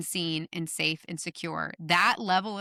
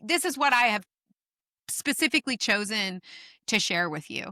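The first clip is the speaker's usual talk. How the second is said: emphatic retort; 6 semitones higher than usual. Retold or imitated. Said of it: "because I'm like".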